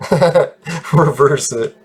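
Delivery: slow words broken by a real laugh; laughing while talking. Real, not acted. Gamer joking about his maybe habit, laughing while talking. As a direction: chuckle through the phrase. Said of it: "stop laughing while I'm talking".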